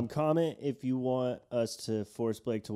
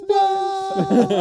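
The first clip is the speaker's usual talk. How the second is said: much higher, louder anguished cry; mock anguished wail; 9 dB louder than usual. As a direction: wail as if heartbroken. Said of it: "Tommy's just like".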